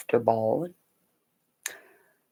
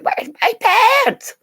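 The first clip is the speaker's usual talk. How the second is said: much higher, louder, slow drawn-out cry; mock whiny yell; 8 dB louder, slower than usual. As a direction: wail it like a kid missing his pants. Son yelling about his pants.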